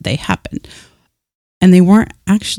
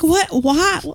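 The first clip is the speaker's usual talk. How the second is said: mock indignant surprise; 13 semitones higher, 4 dB quieter than usual. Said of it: "I'm like".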